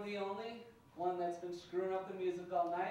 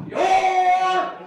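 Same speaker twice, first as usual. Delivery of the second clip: huge high drawn-out shout; Vince McMahon; 13 semitones higher, 22 dB louder than usual. Acted. Comic fixing a proverb with emphasis, bellowed. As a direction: roar it with full showman's emphasis. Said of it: "Do it Vince McMahon style".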